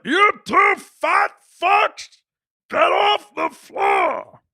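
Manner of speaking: drawn-out, high tongueless bellow; mock angry garbled yell; Uncle Rick. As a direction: shout at them with half a tongue. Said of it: "He would talk like this".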